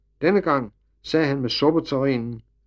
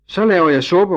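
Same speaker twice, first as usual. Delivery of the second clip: much higher, louder shout; 7 semitones higher, 9 dB louder than usual. A narrator's reading. Maid yelling after the leaving wagon, high and loud. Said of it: "råbte Line".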